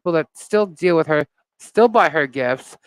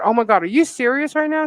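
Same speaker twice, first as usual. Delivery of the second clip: incredulous; 8 semitones higher than usual. Retold or imitated. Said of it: "and I said".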